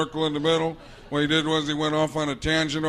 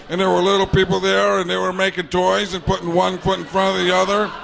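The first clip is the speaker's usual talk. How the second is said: ordinary delivery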